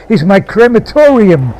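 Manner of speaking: high, ringing proclamation; sudden cry. A narrator's reading. Man declaring the derelict his crematorium, crying out. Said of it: "said with a sudden cry".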